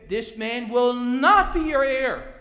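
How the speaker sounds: loud, slow stress on "not"; emphatic decree. Acted. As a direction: hit "not" hard and stretch it as God's verdict. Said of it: "God emphatically says".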